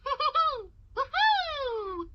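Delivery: stretched-out cartoon excitement building into high hoots; gleeful owl bursting with excitement; excited. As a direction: play it thrilled, drawing out the big words. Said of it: "I am so excited".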